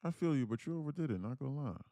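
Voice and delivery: high put-on voice for reading a viewer's comment; Queen D. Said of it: "I was just reading Queen D's comment".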